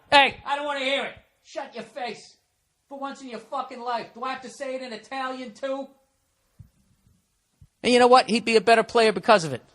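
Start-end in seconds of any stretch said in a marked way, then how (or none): 0.1-5.9 a lightly voiced stern coach, higher and much softer; hammed-up coach hushing his star, pitched up. Coach voiced scolding Kobe.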